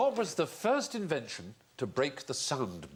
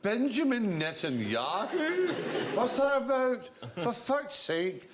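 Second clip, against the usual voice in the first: slow, higher Scottish riff on the name; Billy Connolly impression; 8 semitones higher, slower than usual. Connolly riffing down the phone, slow Scottish. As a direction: savour each syllable, exasperated, in Scots. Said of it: "He went".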